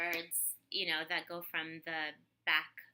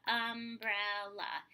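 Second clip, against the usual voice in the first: umbrella in slow separate syllables; broken into parts; 3 semitones higher, 3 dB louder, much slower than usual. Shown as a demonstration. Therapist models tapping umbrella out, slow beats. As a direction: tap out each beat of umbrella. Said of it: "the word is broken up into parts".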